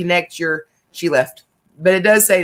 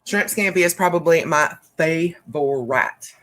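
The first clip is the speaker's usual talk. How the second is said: ordinary delivery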